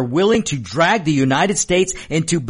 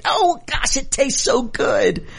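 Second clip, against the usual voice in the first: high, slow, relishing every word; mock gloating Democrat; savoring voice; 12 semitones higher, slower than usual.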